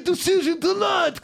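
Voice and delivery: normal talking voice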